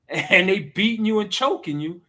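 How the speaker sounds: ordinary delivery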